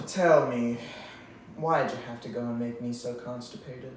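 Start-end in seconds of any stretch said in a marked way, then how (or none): none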